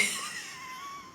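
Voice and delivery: high, quiet squeal of a laugh; amused laugh. Real, not acted. Laughing at the speaker's own old dreams, amused laugh. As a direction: laugh softly, high in the throat. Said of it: "it's funny to me now".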